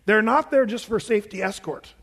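ordinary talk

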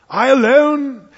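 loud, slow, drawn-out British stage voice; Peter O'Toole. Acted. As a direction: proclaim it grandly in a British accent. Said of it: "Peter O'Toole".

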